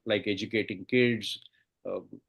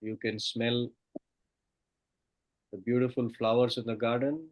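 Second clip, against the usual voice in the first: soft spa whisper; 4 dB quieter than usual. Acted. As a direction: lull them down with each count. Guided meditation countdown.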